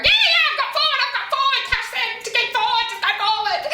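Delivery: hammed-up rider who thinks running is forward; mock frantic rider. Mocking rider with horse running.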